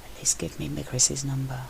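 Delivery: soft, pleading, half-whispered; softly begging for a number; plaintive whisper. A narrator's reading. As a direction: ask quietly, sounding lost. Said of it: "whispered plaintively".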